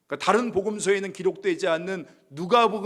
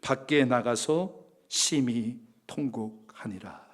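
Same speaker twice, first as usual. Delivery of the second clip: slow scripture reading; 4 semitones lower, much slower than usual. Shown as a demonstration. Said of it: "육십이 절에".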